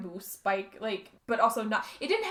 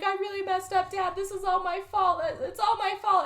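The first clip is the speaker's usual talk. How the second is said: higher, pleading daughter voice; distraught daughter voice; 9 semitones higher than usual. Retold or imitated. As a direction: play the guilty daughter begging her dad. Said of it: "And she's like".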